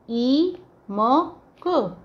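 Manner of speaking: "ई म क" as separate slow sounds; spelled out sound by sound. Shown as a demonstration. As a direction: sound out ई, म, क one by one, drawn out. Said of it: "दीमक". (ई म क एक-एक ध्वनि अलग करके, धीरे)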